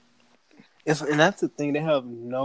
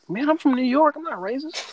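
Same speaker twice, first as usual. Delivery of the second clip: high, defensive mock voice; mock liberal; 11 semitones higher than usual. Mocking a liberal who denies racism, high and defensive. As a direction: play the defensive liberal, pitched up. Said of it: "They be like".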